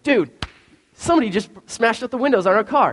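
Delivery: imitated alarm. Friend barging in with alarming news.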